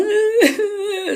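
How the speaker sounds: high-pitched imitated sob; mock crying. Woman showing her little moments on the floor, mock crying. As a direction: act out a little breakdown wail. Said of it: "having one of these little".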